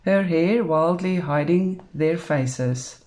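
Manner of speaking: ordinary delivery, nothing marked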